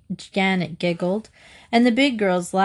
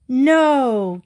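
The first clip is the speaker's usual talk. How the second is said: higher, louder gasp of disbelief; 4 semitones higher, 5 dB louder than usual. A narrator's reading. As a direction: let out a shocked "no". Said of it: "Katy gasped".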